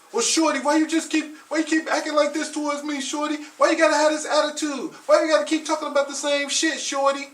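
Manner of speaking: hammed-up complaining boyfriend on repeat; exasperated young man. Frustrated boyfriend nagging shorty about her attitude, loud and high.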